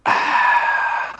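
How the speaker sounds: loud, long voiceless sigh; heavy sigh. Real, not acted. After brushing off the girl's injury, loud heavy sigh. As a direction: heave out a big sigh.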